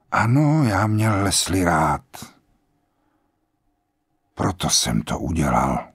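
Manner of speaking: lightly voiced grave general; old general, calm and grave. A narrator's reading. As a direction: speak like a resigned old man at peace.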